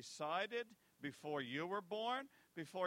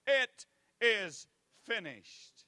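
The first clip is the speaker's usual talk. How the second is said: much louder, slow, higher triumphant shout; victorious shout; 7 semitones higher, 12 dB louder, much slower than usual. Acted. Jesus announcing victory to the world, slow loud shout. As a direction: shout it so the whole world hears. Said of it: "it was shout".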